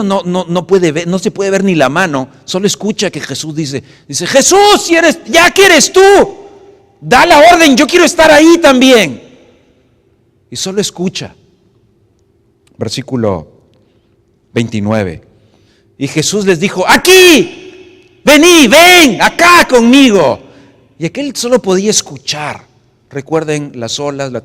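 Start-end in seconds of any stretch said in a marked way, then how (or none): 4.3-9.2 very high, loud plea; eager shout, much higher and louder; calling out. Acted. Peter calling Jesus in dark. 16.9-20.4 high, loud, slow call; drawn-out call, much higher and louder. Acted. Jesus calling Peter over waves.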